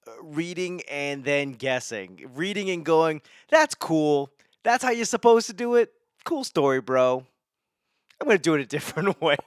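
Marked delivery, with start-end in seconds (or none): 3.5-9.4 louder, high, cocky brush-off of the recipe; cocky cool-story-bro sneer, quick and loud; mock dismissive. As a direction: scoff at the instructions like a know-it-all. Mocking the recipe as he reads it.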